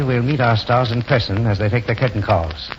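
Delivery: ordinary talk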